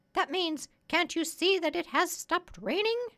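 high-pitched parrot voice; explaining parrot. Acted. Parrot translates for the doctor.

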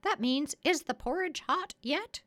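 high-pitched parrot voice; explaining parrot. Acted. Parrot translates for the doctor.

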